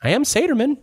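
high-pitched put-on villain voice; high character voice. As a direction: declare the name in a high voice. Game master answers as Saterman.

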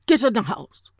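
badly faked Jamaican accent, commanding; horrible Jamaican accent. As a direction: imitate a Jamaican granddaddy giving orders. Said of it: "this is a horrible Jamaican accent".

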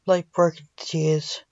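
usual speaking voice

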